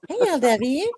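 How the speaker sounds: high, coaxing creepy clown. Pretend. Streamer's clown voice offers a friend some nerds, high and coaxing. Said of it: "Do I sound like a creepy clown yet?".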